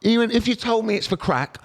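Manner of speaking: high-pitched exasperated retelling of a telling-off; indignant telling-off. Retold or imitated. One man retells a bystander confronting a beggar, high mock indignation.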